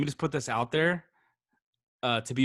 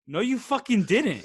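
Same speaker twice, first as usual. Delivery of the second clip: high, drawn-out contradiction, half laughing; mock-indignant denial, stretched out high; disbelieving call-out; 8 semitones higher than usual. Retelling how he called out the lie.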